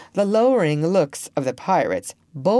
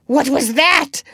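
high, loud startled question; alarmed cry; 10 semitones higher, 6 dB louder than usual. A narrator's reading. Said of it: "cried Hook".

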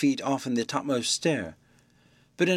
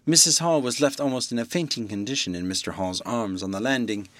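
ordinary delivery, nothing marked

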